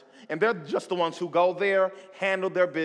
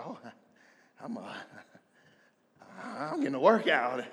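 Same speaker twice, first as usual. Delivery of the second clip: out of breath; 2 semitones lower, 8 dB quieter than usual. Retold or imitated. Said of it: "feeling kinda winded".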